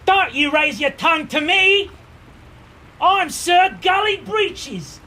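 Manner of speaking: high, loud blustering knight; indignant knight. Acted. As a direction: shout in a puffed-up, high-pitched knight voice. Blustering knight challenges the visitors.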